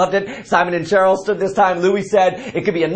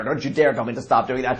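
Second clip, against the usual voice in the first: normal talking voice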